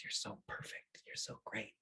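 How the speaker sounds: breathy whispered praise; whisper in her ear. Acted. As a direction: whisper lovingly into the child's ear. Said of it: "in her ear".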